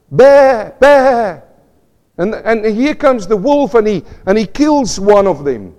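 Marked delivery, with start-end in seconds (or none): 0.1-1.4 loud, high "Bear, bear" bleated like a sheep; imitated sheep bleat.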